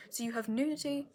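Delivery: ordinary talk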